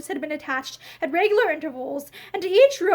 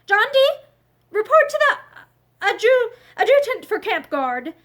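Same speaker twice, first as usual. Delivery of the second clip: calling out; 5 semitones higher, 5 dB louder than usual. A narrator's reading. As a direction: call him out for guard duty. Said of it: "called out".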